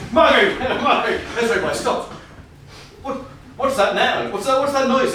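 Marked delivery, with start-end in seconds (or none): 3.0-5.1 put-on a touch of an American accent, higher; lightly voiced alarmed American astronaut; nervous American astronaut, lightly done. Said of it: "but in an American accent".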